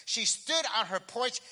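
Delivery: ordinary talk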